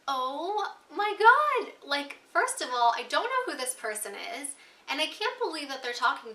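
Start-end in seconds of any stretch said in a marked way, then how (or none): 0.1-1.7 high, loud, slow "oh my God" in disbelief; retold exasperated gasp. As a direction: drag it out, stunned and fed up. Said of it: "And I was like".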